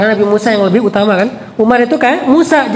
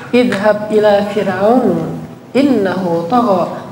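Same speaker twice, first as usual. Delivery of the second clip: slow, measured Arabic recitation; unhurried scripture chant; Quran recitation; 3 semitones lower, much slower than usual. Acted. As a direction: recite it slowly and reverently.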